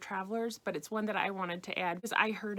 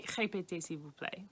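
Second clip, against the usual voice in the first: Répétez, s'il vous plaît, softer and gentle, rising at plaît; polite request; 2 semitones lower, 4 dB quieter than usual. Shown as a demonstration. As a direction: ask nicely, softly. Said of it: "much more polite to say".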